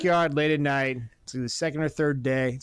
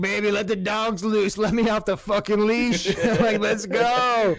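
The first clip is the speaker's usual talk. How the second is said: higher, keyed-up begging; hammed-up eager pleading; 5 semitones higher than usual. Husband begging wife to let him at Pat, worked up. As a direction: plead to be unleashed, pitch raised.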